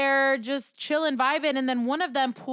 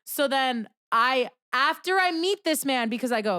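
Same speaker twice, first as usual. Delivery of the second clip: ordinary talk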